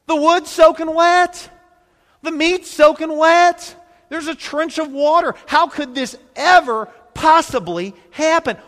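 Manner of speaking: high, loud incredulous exclaiming; amazed. Real, not acted. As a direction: marvel at the impossible odds. Preacher marvels at soaked altar.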